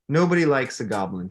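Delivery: ordinary delivery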